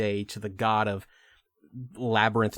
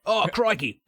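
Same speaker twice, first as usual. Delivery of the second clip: British crikey; 9 semitones higher, 4 dB louder than usual. Pretend. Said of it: "I can say crikey".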